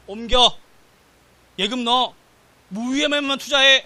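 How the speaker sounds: acted scolding in short loud orders; bossy wife's orders. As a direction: imitate a wife laying down the law. Wife ordering him, acted out.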